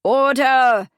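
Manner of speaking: loud, high, angry call for order; angry exclamation. A narrator's reading. Judge quelling laughter in the courtroom, angry shout. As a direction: thunder it angrily at the room. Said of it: "he exclaimed angrily".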